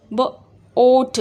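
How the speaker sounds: ब- then ओट, drawn out; teacher sounding out बोट; slow sound-out. Shown as a demonstration. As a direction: blend ब- ओट slowly. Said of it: "आट नहीं बोलते ना".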